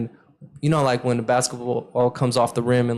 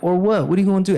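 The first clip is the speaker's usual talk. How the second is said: higher-pitched retold dare; cocky challenge; 7 semitones higher, 3 dB louder, faster than usual. Warned player daring the big guy, cocky challenge. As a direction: call his bluff, confidently. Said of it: "I've been kinda confident".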